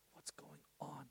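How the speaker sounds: breathless whisper of amazement; hushed, unvoiced "What's going on?"; whispered wonder. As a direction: whisper it, awestruck. Whispering his own amazement.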